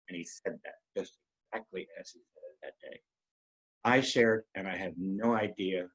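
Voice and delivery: ordinary talk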